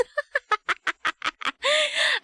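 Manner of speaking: high, long burst of giggles; laughing. Real, not acted. Streamer cracking up at being teased, high laughter. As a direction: break into high-pitched, rapid laughter.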